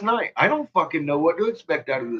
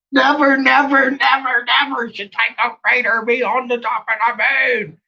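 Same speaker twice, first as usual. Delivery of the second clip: shouted never; 8 semitones higher than usual. Real, not acted. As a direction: hammer the never again as a rule. Repeating the shouted moon rule.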